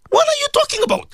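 high, incredulous exclamation; indignant disbelief. Real, not acted. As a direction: throw the question up in disbelief. Indignant rhetorical question.

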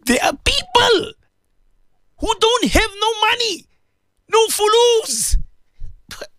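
fervent preacher's dua with a catch of tears near the end; impassioned prayer. Acted. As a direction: beg God from the heart, voice lifted high. Impassioned dua on live radio.

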